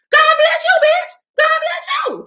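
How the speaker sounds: squeaky high mock blessing, shouted; hammed-up high beggar voice yelling God bless you; shrill mock beggar. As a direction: screech the blessing like a mocking parrot.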